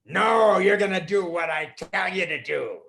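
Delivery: loud, stern refusal; harsh magician. Acted. Magician refusing the boy, loud stern voice. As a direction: slam the door on his hope.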